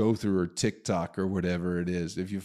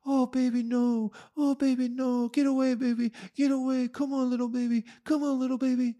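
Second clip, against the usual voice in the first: way higher, fussing mock coo at the animal; mocking safari tourist. Mocking rich safari tourists, high cooing baby talk. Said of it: "these people on the safari are like, free going".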